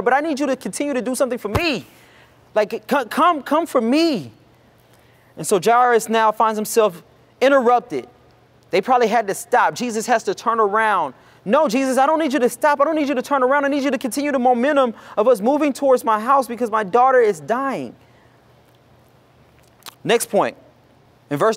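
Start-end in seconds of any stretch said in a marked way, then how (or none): 0.0-4.3 urgent, rising begging; desperate plea. Acted. Worshipper begging God, voiced. 11.4-17.9 higher, urgent begging; pleading father. Acted. Jairus urging Jesus on to his dying daughter, urgent plea.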